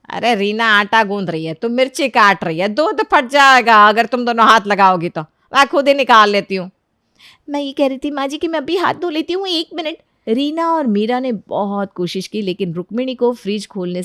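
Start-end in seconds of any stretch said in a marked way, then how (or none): 0.1-6.7 firm, louder toward the end; brisk mother-in-law, insistent. Acted. Mother-in-law refuses help with the milk, firm and insistent. 7.5-10.0 high, pleading voice; anxious daughter-in-law, stalling. Acted. Daughter-in-law stalls mother-in-law at the fridge, high and nervous.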